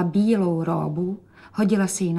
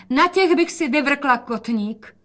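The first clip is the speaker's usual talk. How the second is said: calling out; 6 semitones higher, 3 dB louder, slower than usual. A narrator's reading.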